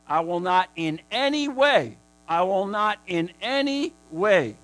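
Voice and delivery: loud, slow insistent repetition; preaching at full force. Acted. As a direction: drive the promise home. Preacher repeats God's promise.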